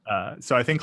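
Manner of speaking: normal talking voice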